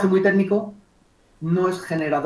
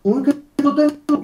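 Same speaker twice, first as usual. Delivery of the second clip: high, put-on elated exclamation; super happy; 7 semitones higher than usual. Client telling the coach how happy they are, elated. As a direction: play up the client's delight. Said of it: "súper".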